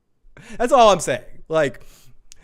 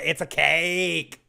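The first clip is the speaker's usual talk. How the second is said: Deep Space Nine quote; much slower than usual. Retold or imitated. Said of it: "Deep Space Nine fans will understand".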